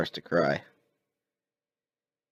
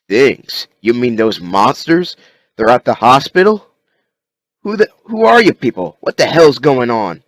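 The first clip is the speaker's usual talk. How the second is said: louder, rising panicked questions; agitated demands; 5 semitones higher, 9 dB louder than usual. Acted. Rick waking among strangers, loud and rising panic. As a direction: demand answers, getting frantic.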